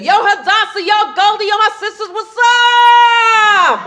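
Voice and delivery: put-on high-pitched Harlem kid hollering; street call-out. Kid greeting sisters on a Harlem street, high excited call. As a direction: call out to your friends, high and hyped. Voicing kids calling out in Harlem.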